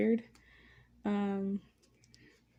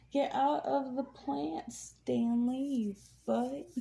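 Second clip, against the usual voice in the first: scolding the cat; 3 semitones higher, slower than usual. Real, not acted.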